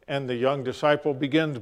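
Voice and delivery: ordinary talk, nothing marked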